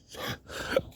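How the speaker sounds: short unvoiced laugh; breathy laugh. Real, not acted. Laugh after gas money.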